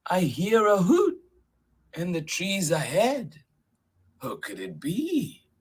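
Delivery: high, suspenseful questioning; lifted storybook voice, listening out; wondering Gruffalo. Acted. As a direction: puzzle over the sound ahead. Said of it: "till the Gruffalo said".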